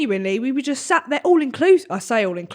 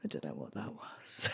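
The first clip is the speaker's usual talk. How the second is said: much lower, much softer; dazed mumble; 23 dB quieter than usual. Retold or imitated. Flustered man returns to table, low and dazed. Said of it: "and he goes".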